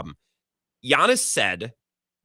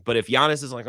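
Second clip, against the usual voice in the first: ordinary talk, nothing marked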